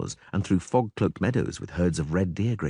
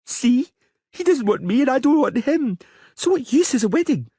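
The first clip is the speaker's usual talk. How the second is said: put-on high girlish voice, stubborn and petulant; a spoiled little princess making her case; young girl Ciri; 5 dB louder than usual. A little princess arguing with the Witcher, high girlish voice.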